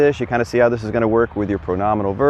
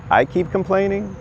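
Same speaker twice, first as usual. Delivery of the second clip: mock disbelief; 10 semitones higher than usual. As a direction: pitch jumps way up in mock disbelief. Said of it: "And I'm like".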